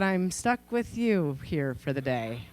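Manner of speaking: low, slow, soft sulk; a kid moping about a lost day; sulky thought. Retold or imitated. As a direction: grumble it under your breath. Child's sulky thought about mom.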